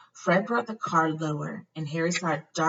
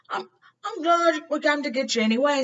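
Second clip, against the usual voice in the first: high, tearful Ron voice; Ron, choked up; 11 semitones higher, 4 dB louder than usual. A narrator's reading. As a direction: Ron almost crying with relief.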